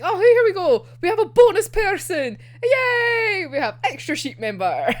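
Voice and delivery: hammed-up cheer for a bonus member; mock cheering. Anime watcher greeting the new sheep member, mock cheer. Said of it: "then it's like".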